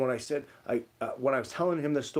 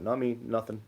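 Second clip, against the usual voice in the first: ordinary talk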